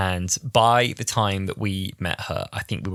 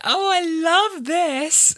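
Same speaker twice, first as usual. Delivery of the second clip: very high-pitched gushing admiration; gushing star; 4 dB louder, slower than usual. Retold or imitated. Retelling [name] admiring the fan's getup, gushing and high. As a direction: imitate a star delighted by an outfit. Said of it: "And she was like".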